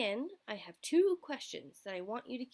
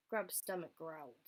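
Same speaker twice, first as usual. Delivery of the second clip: usual speaking voice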